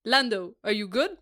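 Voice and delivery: lightly voiced Carlos accent; a hint of a Spanish lilt. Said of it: "that was actually quite good".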